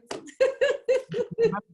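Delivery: loud high laugh at his own joke; high burst of laughter. Real, not acted. Laughing at his own mock impression, loud high laugh.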